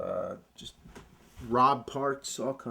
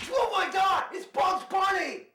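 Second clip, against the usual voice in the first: high excited shout. Acted. Repeated high Bugs Bunny exclamation.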